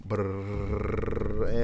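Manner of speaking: a rolled brrr imitating a drum roll; lip-trilled drum roll. Host announcing the big moment, mouth drum roll. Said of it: "Drum roll".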